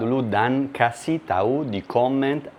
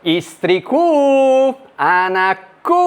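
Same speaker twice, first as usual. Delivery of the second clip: calling out; 13 semitones higher, 4 dB louder than usual. Real, not acted. Calling family to come eat.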